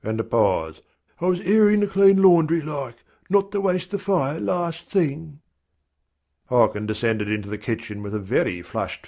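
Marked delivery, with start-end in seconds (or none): 1.2-5.4 high-pitched manservant, slowing as he explains; manservant making excuses. A narrator's reading. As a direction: explain yourself to the master in a higher voice.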